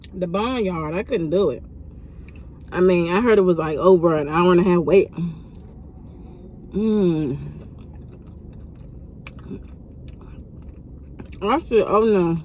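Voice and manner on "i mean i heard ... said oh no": talking while chewing; biting into food; mouth full. Real, not acted. Seen 3: Holds chicken throughout; bites into it from 2.5 seconds while still speaking.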